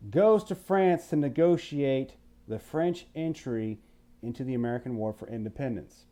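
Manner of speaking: ordinary talk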